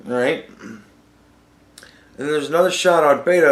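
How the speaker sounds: ordinary talk, nothing marked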